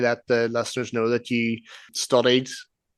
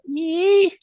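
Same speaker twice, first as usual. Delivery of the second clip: high, drawn-out little whine. Retold or imitated. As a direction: squeal out a long, thin "mee". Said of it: "it's not even a cry. It's like a".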